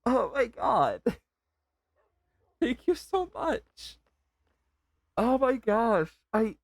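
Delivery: very high, emotional thanks; overwhelmed, near tears. Real, not acted. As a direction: struggle for words, voice lifted high with emotion. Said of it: "Can hear it in my voice".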